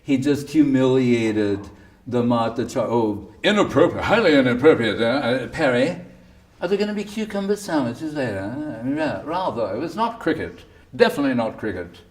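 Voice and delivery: prissy, scandalized voice with a laugh in it; mocking pearl-clutching tut-tutting; mock indignant critic. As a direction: lampoon the offended critics, fussing over manners. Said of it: "All you can say is".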